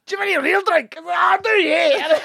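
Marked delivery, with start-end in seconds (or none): 0.1-0.9 very high, mangled Scots caricature; thick Scottish accent. Retold or imitated. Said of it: "had the same accent as the fella". 1.1-2.2 slow, high Scottish trolley-man voice; thick Scottish accent. Retold or imitated. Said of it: "man behind the trolley had the same accent".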